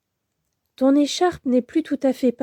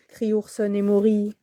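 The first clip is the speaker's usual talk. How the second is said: ordinary delivery, nothing marked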